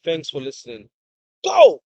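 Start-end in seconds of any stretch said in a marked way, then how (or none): 1.4-1.8 high, explosive shout; sign-off shout. Real, not acted. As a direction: fire out one loud closing cry.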